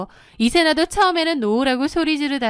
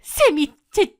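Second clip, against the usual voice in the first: high, halting, shaken mother; trembling voice; 9 semitones higher than usual. A narrator's reading. Mother confronting her son at the wedding, voice trembling. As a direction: stammer it out in trembling shock. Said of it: "덜덜 떨리는 목소리로 묻는 거예요".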